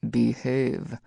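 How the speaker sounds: lightly voiced deep-timbred man; deep timbre. A narrator's reading. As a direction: deepen it a touch, as a warning. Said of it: "voice took on a deep timbre".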